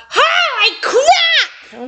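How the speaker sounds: high, very slow drawn-out yell. Acted. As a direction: bellow it over the alarm. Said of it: "Ryder yelling over the line".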